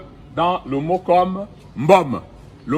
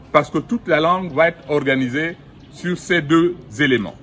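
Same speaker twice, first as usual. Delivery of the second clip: ordinary talk, nothing marked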